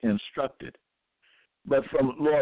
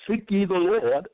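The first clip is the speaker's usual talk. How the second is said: emphatic scripture quote; 7 semitones higher than usual. Acted. Re-reading the verse with emphasis.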